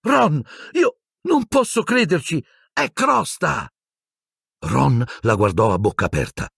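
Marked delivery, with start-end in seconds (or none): 0.0-3.7 high, shrill-edged cry of disbelief; startled girl's cry, high up; shocked shriek. A narrator's reading. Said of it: "si lasciò sfuggire uno strillo".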